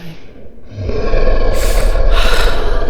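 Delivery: loud heavy sigh. Acted. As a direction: let out a huge rushing breath. Heavy gust as dragon arrives.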